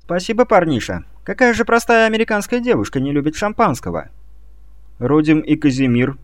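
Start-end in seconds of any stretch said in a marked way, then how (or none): 0.0-4.1 put-on high ditzy American girl voice; cartoon princess. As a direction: gush it like a naive cartoon princess. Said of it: "вновь решила стать Джулией".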